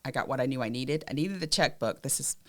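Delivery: normal talking voice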